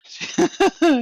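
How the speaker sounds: sudden high, loud laugh. Real, not acted. Teller cracks up mid-story, high laugh. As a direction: burst of high-pitched laughter.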